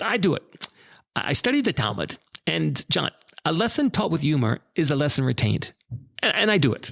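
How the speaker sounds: a hint of a tiny, wise old lady; Doctor Ruth impression. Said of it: "I can't do a great impersonation".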